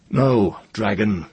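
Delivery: loud, high, firm refusal; ringing, high rebuff; defiant young wizard. A narrator's reading. Ged refuses the dragon's invitation.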